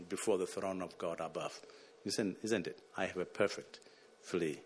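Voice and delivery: 1 soft hymn quote. Real, not acted. Quoting a hymn softly.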